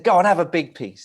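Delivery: mock-encouraging prankster; imitated egging-on. Urging Nick to take cake.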